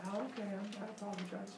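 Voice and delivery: very soft, timid apology; hushed scared kid voice; meek. Retold or imitated. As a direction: shrink away as you apologize. Cowed son.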